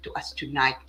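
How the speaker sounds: normal talking voice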